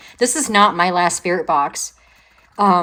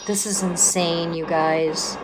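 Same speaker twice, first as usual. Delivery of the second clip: hushed awe; 4 semitones lower, 7 dB quieter, much slower than usual. Real, not acted. Quiet awe after the answer.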